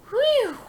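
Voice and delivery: high, loud relieved whew. A narrator's reading. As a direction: let out a big sigh of relief. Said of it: "breathed Donald".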